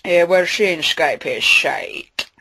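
slow, slurred Scottish Connery voice; lazy Connery "sh" on every s; Sean Connery. Retold or imitated. Connery on the Skype podcast, slow Scottish slur. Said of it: "Sean Connery".

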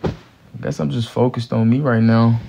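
usual speaking voice

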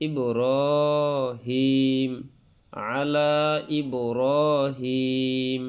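ইব্রাহিম drawn out very slowly, long vowels held; three-alif stretch. Shown as a demonstration. Teacher modelling a long madd on ইব্রাহিম for students. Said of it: "তিন আলিফ টানতে হয়".

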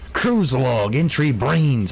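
hearty sea captain voice; pirate voice. Acted. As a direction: read it like an old pirate. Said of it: "I'll read it in a pirate voice".

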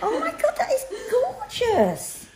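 high, tearful laughing delight; overcome, laugh-crying; laughing through tears. Real, not acted. Moved by gift, laughing tearfully.

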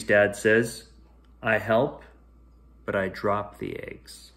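ordinary delivery, nothing marked

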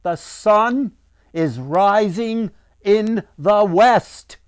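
loud, high, slow proclamation; crying out. A narrator's reading. Father cries from a stone bench to the crowd, loud and slow. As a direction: proclaim it slowly, building to a shout. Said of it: "and cried".

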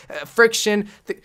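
ordinary talk

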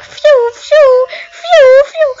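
high loud puffing phew; huffing adult player. Retold or imitated. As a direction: puff out like a struggling adult. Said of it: "they'll be like".

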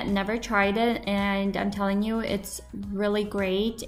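ordinary talk, nothing marked

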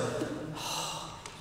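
long, soft, breathy sigh; griping sigh. Acted. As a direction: sigh as if discouraged by comparison. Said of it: "gripe about our situation".

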